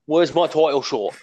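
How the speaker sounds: gruff British wrestler voice; British Bulldog impression. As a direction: growl it like a British brawler. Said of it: "is my British Bulldog impression".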